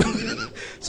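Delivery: high burst of laughter; a sudden high laugh; real laugh. Real, not acted. The speaker laughing at his own story, real high laugh.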